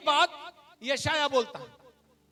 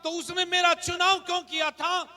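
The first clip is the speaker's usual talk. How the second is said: raised, driving sermon voice; impassioned preaching; 4 semitones higher, 6 dB louder than usual. Real, not acted. Pastor declaring whom he must know, impassioned preaching. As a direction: build insistent repeated declarations.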